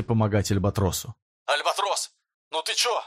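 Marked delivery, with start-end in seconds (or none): 1.5-3.0 urgent call to a fallen friend; high urgent call. Acted. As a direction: call his name up high, worried. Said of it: "кричит Пурис".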